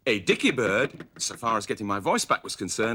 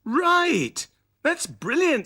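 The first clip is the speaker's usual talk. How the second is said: delighted; 14 semitones higher, much slower than usual. A narrator's reading. Sooty thrilled with Scampy's doll find. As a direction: burst out thrilled. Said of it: "said Sooty".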